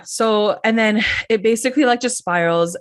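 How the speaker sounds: usual speaking voice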